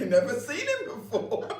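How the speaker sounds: laughing giddy reply. Retold or imitated. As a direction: blurt it out through giggles. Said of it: "I said".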